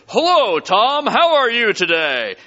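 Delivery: slow, overly ceremonious hello; prim, ceremonial greeting; formal greeting. Retold or imitated. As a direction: greet him with stiff formality. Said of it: "all ceremonially".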